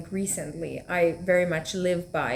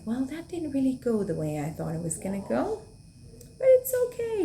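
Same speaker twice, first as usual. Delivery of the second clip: put-on calm little boy; 6 semitones higher than usual. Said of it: "very calmly and was like".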